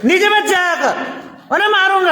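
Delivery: high-pitched mock telling-off; angry papa scolding. Shown as a demonstration. Teacher models papa's warning for the child, acted angry. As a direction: scold like a cross father, pitched well up. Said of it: "ऐसे बोलते हैं गुस्से से".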